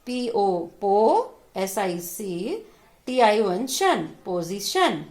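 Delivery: "Position" built slowly from पो, सी, शन; slow teacher's sounding-out of "position"; spelled out by syllable. Shown as a demonstration. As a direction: spell each chunk, sound it, then join as "position". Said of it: "इनको हम अलग-अलग करके पढ़ेंगे".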